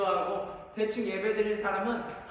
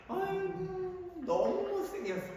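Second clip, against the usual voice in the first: mocking tease; 10 semitones higher, 4 dB quieter, slower than usual. Acting friends mocking a girl.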